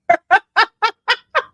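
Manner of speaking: high, loud burst of laughter; big laugh. Real, not acted. Vlogger laughs at the doorless restaurant, high loud laughter.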